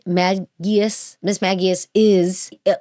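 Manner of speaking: ordinary talk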